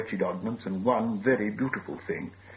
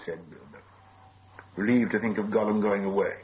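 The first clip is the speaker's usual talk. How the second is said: ordinary delivery, nothing marked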